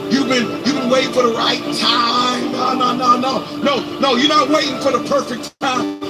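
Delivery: ordinary talk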